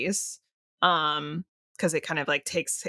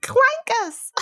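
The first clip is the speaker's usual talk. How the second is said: mock clone voice; 5 dB louder than usual. Echoing clone impression, laughing.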